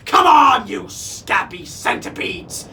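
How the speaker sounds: pirate yell. A narrator's reading. Captain yelling at his crew, furious shout. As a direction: bellow at the crew like a mad captain. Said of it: "yelled Captain Snatchit and his crew".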